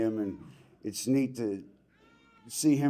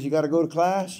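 louder, higher firm order; coach insisting; 7 semitones higher, 7 dB louder than usual. Retold or imitated. Coach telling young Pat to attend class, loud and firm. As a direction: bark it like a demanding coach. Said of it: "He says".

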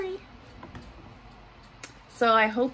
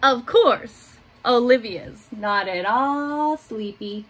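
put-on gentle picture-book reading for little kids; reading to children; 4 dB louder than usual. A narrator's reading. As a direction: read it like story time in class. Teacher reads picture book aloud.

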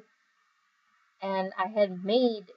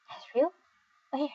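"Azrael?" echoed with a high questioning rise; puzzled question; 5 semitones higher, 4 dB quieter than usual. Acted. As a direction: ask "Azrael?" as if looking around for him. Puzzled echo, then jokes "Where?.

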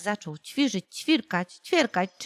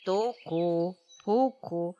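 woodpecker knock; 4 semitones lower, 3 dB quieter, slower than usual. A narrator's reading. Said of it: "na to dzięcioł".

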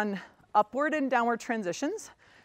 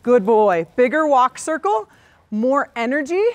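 louder praise; 8 dB louder than usual. Real, not acted. Trainer urging horse for bigger canter.

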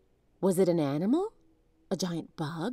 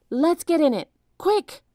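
higher, louder hurried call; urgent; 9 semitones higher, 7 dB louder than usual. A narrator's reading. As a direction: rush her into the canoe. Escaping the ants.

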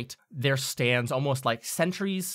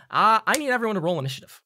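a quick, louder dramatic announcement; roll initiative; 8 semitones higher, 6 dB louder, faster than usual. Real, not acted. Game master calls the party to roll initiative. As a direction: launch the fight with a flourish.

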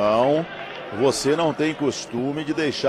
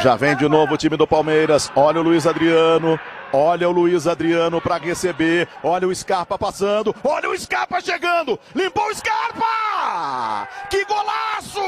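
explosive goal commentary. Real, not acted. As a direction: build from urgent call to a wild goal scream. Second goal build-up and call.

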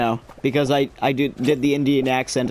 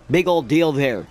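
usual speaking voice

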